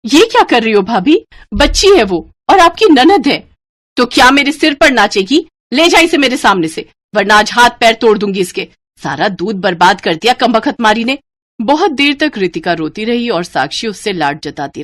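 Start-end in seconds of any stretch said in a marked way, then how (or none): 0.0-3.5 loud, high indignant outcry; angry protest. Acted. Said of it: "गुस्से से बबीता से कहा". 4.0-11.2 furious threats. Acted. Babita rages after the slap.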